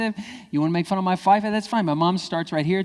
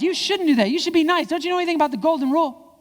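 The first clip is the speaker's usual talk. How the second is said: high, loud preachy nagging; hammed-up moralizer, pitched way up; moralizing scold; 13 semitones higher, 5 dB louder than usual. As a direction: lecture a child in mock outrage.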